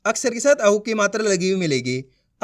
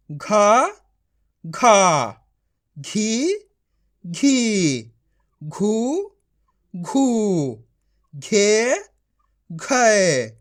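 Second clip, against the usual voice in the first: drawn-out syllable drill, each one separate; बारहखड़ी recitation; much slower than usual. Shown as a demonstration. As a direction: chant each syllable for pupils to repeat, pausing between. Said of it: "मैं किस तरीके से पढ़ रहा हूँ". (बारहखड़ी का खिंचा हुआ पाठ)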